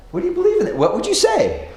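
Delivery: high quizzical question. Retold or imitated. Imagined stranger asking churchgoer.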